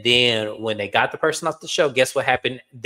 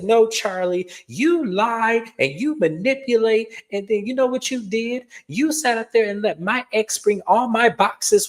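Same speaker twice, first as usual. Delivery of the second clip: higher pitched mocking imitation of Georgia; 9 semitones higher than usual. Georgia accusing Charlie at the reunion, mocking higher voice. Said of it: "And she said".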